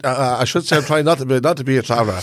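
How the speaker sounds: normal talking voice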